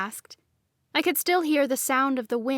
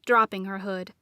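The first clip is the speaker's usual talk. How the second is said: ordinary delivery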